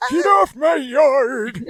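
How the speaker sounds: slow, high yelling geezer; grumpy old man shout. Acted. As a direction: holler at the kids, slowly.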